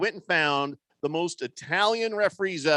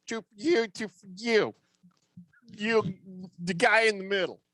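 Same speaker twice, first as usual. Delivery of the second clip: confused referee; 4 semitones higher than usual. Retold or imitated. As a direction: act out a ref lost in the free throws.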